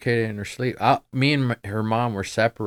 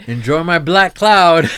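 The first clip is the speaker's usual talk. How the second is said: much higher, much louder joking shout; mock gleeful bequest; 9 semitones higher, 15 dB louder than usual. Joking about what kids will inherit, loud mock-gleeful cry. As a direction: belt it out high as a joke.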